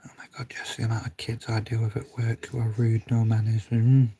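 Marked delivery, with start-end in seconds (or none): 0.1-3.0 hushed whispered aside. Real, not acted. Whispering to Jess about rude kids at work. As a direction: whisper it so only Jess hears.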